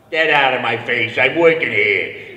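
loud, slow Brooklyn tough guy; tough Brooklyn Italian. Retold or imitated. Brooklyn tough to the producer, loud and slow. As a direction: bark it like a Brooklyn plumber. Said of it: "Italian plumber from Brooklyn".

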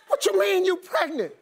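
high-pitched comic disbelief; appalled tone. Retold or imitated. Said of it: "What is that tone in your voice?".

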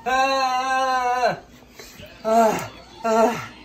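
pained gasps. Real, not acted. Eating very hot sauce.

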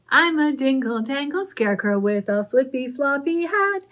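scarecrow chant, high and loud; mild shout at the start of the scarecrow rhyme. Acted. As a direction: call it out like a jolly scarecrow. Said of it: "he shouted very loud".

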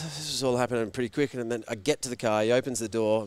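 ordinary talk, nothing marked

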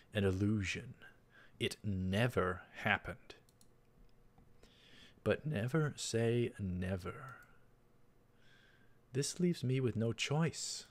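usual speaking voice

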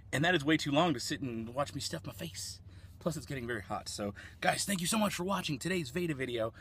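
normal talking voice

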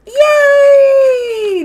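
very high, loud cheer; joyful shout. Real, not acted. Streamer cheers after announcing baby plans to chat. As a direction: shout it out with pure joy.

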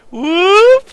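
high, loud swooping "whoop"; imitated dragon dodge. Gamer showing how the dragon goes, imitated whoop. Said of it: "The dragon goes like".

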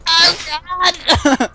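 high, tearful-sounding wail of laughter; laughing like crying. Real, not acted. Player laughing until it sounds like crying. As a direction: wail it through helpless laughter. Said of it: "It sounds like I'm crying".

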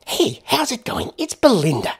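high, perky American woman's voice; cheerful American accent. Acted. Woman greeting a stranger at his door, cheerful American accent. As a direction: chirp it in an annoyingly upbeat American accent. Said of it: "said the woman cheerfully in an annoyingly American accent".

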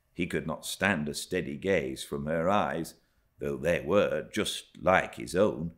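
plain reading voice

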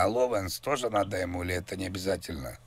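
ordinary delivery

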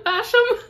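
"Basham" as BASH-um, high rising question; first-syllable stress, baffled. Real, not acted. Reader gives up on the last name, high questioning BASH-um. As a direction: squeak out "Basham" as BASH-um, unsure. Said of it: "No idea how to pronounce".

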